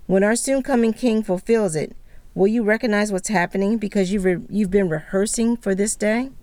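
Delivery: usual speaking voice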